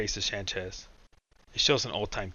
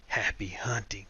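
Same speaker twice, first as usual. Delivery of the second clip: a sly whispered farewell; slower than usual. Acted. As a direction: breathe it out as a parting whisper. Albino speaks as door closes.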